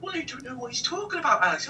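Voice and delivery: a puzzled little English country woman; English country accent. Retold or imitated. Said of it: "little country woman from a little fucking town in England".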